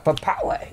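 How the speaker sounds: high-pitched mock defeat noise; silly defeated noise. Player mimics beaten Team Rocket members, silly high noise. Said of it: "I will never make that noise again".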